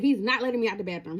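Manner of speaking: plain reading voice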